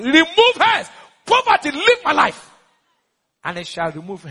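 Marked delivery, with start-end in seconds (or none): 0.0-2.5 high, loud declaration of banishment; forceful command. Shown as a demonstration. Preacher ordering poverty out of his life, high forceful cry.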